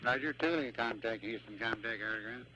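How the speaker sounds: tinny radio voice check; radio chatter. Real, not acted. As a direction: call through the comm loop. Ground station radio network check.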